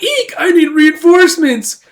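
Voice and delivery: very high mock squeal into a loud, drawn-out cry; hammed-up panic, calling for help; panicked shriek. Attacker facing a slasher, panicked cry for reinforcements.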